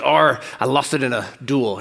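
a light hammed-up pirate voice; pirate accent. The pirate explaining his hand, in a light pirate accent. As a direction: play the pirate for the punchline setup. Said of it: "My pirate accent's really good".

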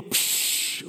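imitated fizzing hiss of a shaken bottle; breathy psh like a cap twisting; hiss of soda. Preacher imitates shaken soda bottle hissing open.

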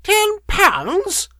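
very high screeching crone; outraged scream. A narrator's reading. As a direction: shriek it as an outraged question. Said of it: "she screamed and raved at him".

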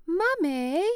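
put-on high toddler call of Mummy; mock child calling Mummy; imitating toddler. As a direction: chirp Mummy up like a little kid. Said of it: "you started going".

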